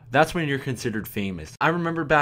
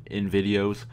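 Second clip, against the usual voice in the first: ordinary delivery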